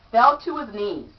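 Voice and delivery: ordinary delivery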